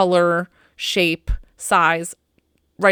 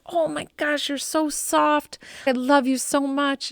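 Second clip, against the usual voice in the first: tender to dog; 7 semitones higher than usual. Retold or imitated. Owner greets her dead dog in a dream with tender cooing. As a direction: coo to the beloved dog.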